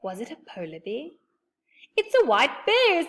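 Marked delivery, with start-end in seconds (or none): 2.0-3.0 high, loud startled cry; frightened high exclamation; half frightened. A narrator's reading. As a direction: blurt it out in fright. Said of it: "half frightened".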